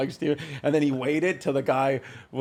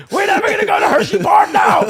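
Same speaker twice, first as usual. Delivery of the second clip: mock screaming; 7 dB louder, faster than usual. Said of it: "I started screaming at my door".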